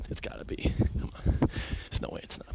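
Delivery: unvoiced, secretive whisper; whispering an aside about a friend. Real, not acted. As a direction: lean in and whisper it to the listeners. Conspiratorial aside to audience.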